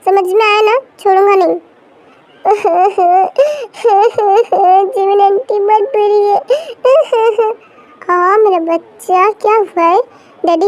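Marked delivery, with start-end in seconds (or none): 2.4-4.9 a child's laugh breaking into a fake cry; playacted child's laugh-cry; childish laugh-cry. 6.4-7.5 put-on short high giggle of a small child; quick high child's laugh; childish giggle.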